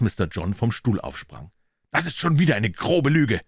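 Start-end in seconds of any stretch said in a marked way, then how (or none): 1.9-3.4 loud, higher indignant call; raised voice, angry at the butler. Acted. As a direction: call out the lie loudly. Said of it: "rief er laut".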